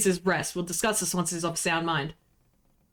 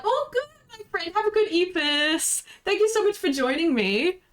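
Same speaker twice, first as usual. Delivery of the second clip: much higher, mocking impression of the captain; 10 semitones higher than usual. Player mocking the captain's drunk welcome, high mock voice. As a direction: squeak it out like a pompous host. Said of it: "Go like".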